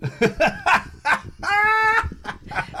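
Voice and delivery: high loud laugh bursting out; loud burst of laughter. Real, not acted. Host cracks up at the guest's Wikipedia line, loud laugh. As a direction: crack up loudly.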